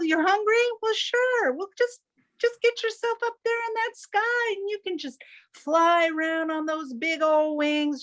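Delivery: high, airy know-it-all bird voice; chirpy hawk giving easy advice; breezy Chicken Hawk. Acted. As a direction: sell him on flying, lightly. Chicken Hawk advising Buzzard.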